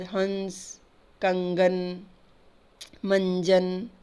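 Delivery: हंस, कंगन, मंजन slowly, the n held in the nose; हंस, कंगन, मंजन as spaced examples with humming nasals; nasal anusvara. Shown as a demonstration. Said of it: "अब नाक की आवाज़".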